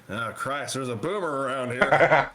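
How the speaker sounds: lightly acted game-character callout; drawn-out mock warning cry; Left four Dead survivor. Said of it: "reminds me of Left four Dead".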